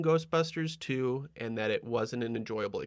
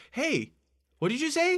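much higher-pitched calling out; mock oblivious questioning. Imitating Clint answering a whispering ghost, mock oblivious. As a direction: call back cluelessly in a high voice.

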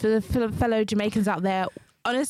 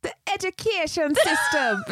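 slow, put-on Jamaican accent, pitched up; bad Jamaican accent; 6 semitones higher than usual. Co-host finally attempts it for the podcast, mock Jamaican accent. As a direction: drop in a hammed-up Jamaican accent. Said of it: "do it in a Jamaican accent".

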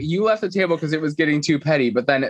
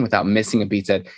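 normal talking voice

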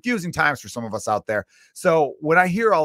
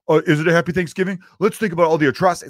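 louder, higher, fast condescending lecture; mock preachy reply guy; 5 semitones higher, 6 dB louder, faster than usual. Mocking the commenter who spoils a holiday post, louder, higher and fast. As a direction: rattle it off like a smug know-it-all.

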